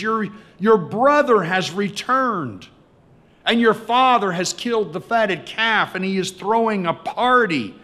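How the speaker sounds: high, emphatic breaking of big news; excited announcement. Acted. Servant tells older brother of the party, excited.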